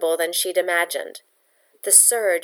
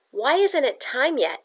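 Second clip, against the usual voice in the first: a higher, insistent demand; 7 semitones higher than usual. A narrator's reading. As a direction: press her sister for an answer. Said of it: "Yetta demanded".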